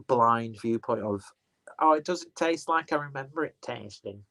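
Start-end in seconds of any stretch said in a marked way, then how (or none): 1.8-4.2 higher-pitched mock Wigan accent. Beer fan grumbling about a changed taste, in a mock Wigan accent. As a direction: mimic a moaner in a put-on Wigan accent. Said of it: "Don't know why I'm doing a Wigan accent".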